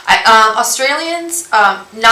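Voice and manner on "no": "no" with a loud, wide Aussie diphthong; Australian "no". Shown as a demonstration. Showing viewers how Australians speak, Aussie "no". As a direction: say "no" like an Australian, nah-oo. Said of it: "I like how you guys say no".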